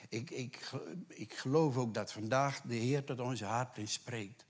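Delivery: normal talking voice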